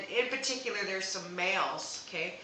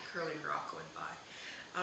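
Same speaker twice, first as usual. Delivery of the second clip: hushed whisper, slow; soft slow whisper; 3 semitones lower, 6 dB quieter, slower than usual. Real, not acted. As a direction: breathe it out slowly in a whisper. Whispering the message to herself.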